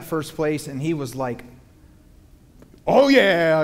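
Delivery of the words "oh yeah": put-on loud, high burst of kid joy; excited kid. Son cheering his dad's winning derby car, loud and excited. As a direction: cheer like a thrilled boy who just won. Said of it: "he was so excited".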